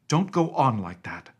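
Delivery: sharp, loud scolding; almost fierce. A narrator's reading. Nurse scolding the miner boy, almost fierce. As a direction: snap at the boy to stop. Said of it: "said the nurse to the boy almost fiercely".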